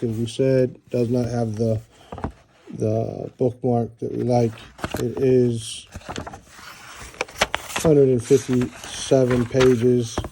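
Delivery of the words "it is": usual speaking voice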